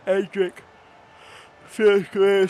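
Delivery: words through a yawn. Real, not acted. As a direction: talk mid-yawn. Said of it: "sorry, I'm yawning".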